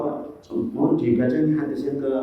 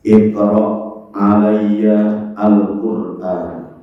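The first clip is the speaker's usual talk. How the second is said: Arabic hadith quote; 4 semitones lower, 3 dB louder, much slower than usual. Acted. Quoting the Prophet in Arabic.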